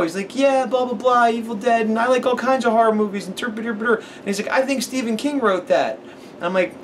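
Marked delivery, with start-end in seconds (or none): 0.3-4.0 put-on little kid voice. Kid rambling. 4.5-6.0 put-on high, slow, earnest little kid. Retelling how the kid described the movie, in a high slow child voice.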